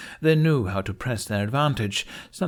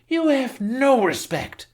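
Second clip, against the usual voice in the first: much higher, slow, loud wail; howling accusation; 4 dB louder, slower than usual. A narrator's reading. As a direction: howl it at him. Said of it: "she howled".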